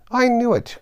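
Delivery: high, loud outburst; wild cry. Acted. Tweedledum finds his broken rattle, crying out high. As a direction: cry out in frantic dismay. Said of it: "cried Tweedledum".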